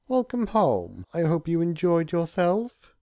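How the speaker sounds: overly sweet to hide the mischief; warm welcome. Acted. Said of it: "Discord greeted warmly".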